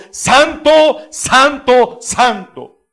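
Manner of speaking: a ringing cry that falls away on each santo; booming then fading chant of holy; loud proclamation fading. Acted. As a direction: call out holy, then let it settle. Angels crying holy to each other.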